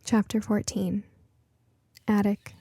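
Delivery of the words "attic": ordinary delivery, nothing marked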